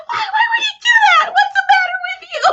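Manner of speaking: high laughing mock scolding; mock yell. Mom yelling at her bleeding daughter, high laughing mock yell. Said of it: "She starts yelling at me".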